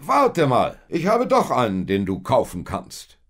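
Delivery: loud, high calling-back; sudden bright idea. A narrator's reading. Rich man calls Josef back to offer a donkey, sudden eagerness.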